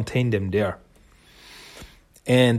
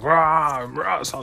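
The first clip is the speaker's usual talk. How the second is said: angry monster growl; 4 semitones higher, slower than usual. Retold or imitated. Tokka enraged as he punches Raph, a slow growl. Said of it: "he gets really angry".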